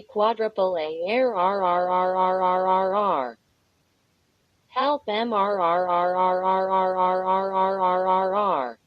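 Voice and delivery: the voice stretches into one long flat mechanical drone, barely above normal; distorting into a drone. Acted. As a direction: let the words melt into a stuck, glitching hum.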